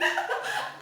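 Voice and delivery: airy squealing laugh; high breathless laugh. Real, not acted. Laughing at her own scream.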